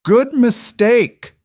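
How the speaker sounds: put-on high, slow, excited child's shout; a little girl yelling with glee. A narrator's reading. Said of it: "Daniel's daughter shouted".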